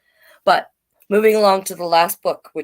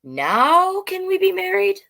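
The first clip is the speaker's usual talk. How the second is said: high, slow hopeful worm voice; little worm asking again, high; pleading worm; slower than usual. Acted. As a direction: ask it wistfully, stretched out.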